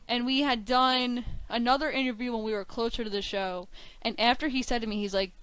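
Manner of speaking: normal talking voice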